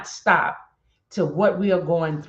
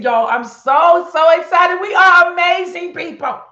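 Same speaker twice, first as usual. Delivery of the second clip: high, loud and thrilled; so excited; 12 semitones higher, 10 dB louder than usual. Real, not acted. To her viewers, bursting with excitement about their people. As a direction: burst with pride and joy. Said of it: "I'm so excited".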